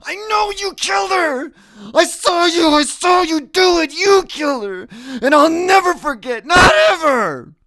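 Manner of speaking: high, shrieking accusation; screaming. Acted. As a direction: scream it at your father with everything. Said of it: "keep screaming".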